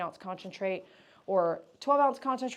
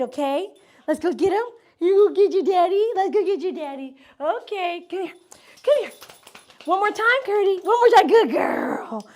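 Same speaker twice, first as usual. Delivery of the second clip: much higher, louder sing-song cooing; 11 semitones higher, 12 dB louder than usual. Real, not acted. Groomer gushing to the happy dog that daddy arrived, high baby talk.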